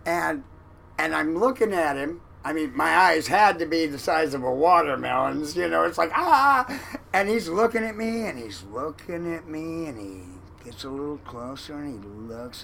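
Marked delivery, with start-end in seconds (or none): 6.1-6.6 sudden high startled cry; startled yell. Retold or imitated. Waking to a raised fist, a startled yelp. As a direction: jolt awake with a yelp.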